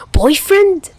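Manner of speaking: high, loud, startled yelp; shocked. Acted. Chuya to Tachihara, shocked at the word boyfriend. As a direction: caught off guard, squeaking it out.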